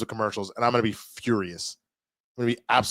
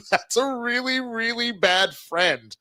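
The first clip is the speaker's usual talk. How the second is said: mocking; 10 semitones higher, slower than usual. Real, not acted. Mocking a bad friend.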